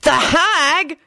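high, disgusted outburst; disgusted bark. A narrator's reading. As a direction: blurt it in dismay at a bad draw. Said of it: "barked out in disgust".

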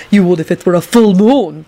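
mild mock bellow. A narrator's reading. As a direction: raise the voice slightly in angry retort. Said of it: "Dragomir bellowed".

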